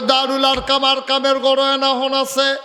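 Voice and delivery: soar into the waz melody; fervent high preaching chant; preaching at full force. Acted.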